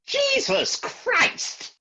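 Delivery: high startled exclamation; a sudden horrified blurt; shocked outcry. Acted. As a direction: jolt up in pitch as if something crashed.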